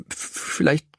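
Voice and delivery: zögerlich, leicht zittrig gestammelt; stammelnd. A narrator's reading. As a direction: stammel es unsicher. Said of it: "stammelt er". (stammelnd und unsicher)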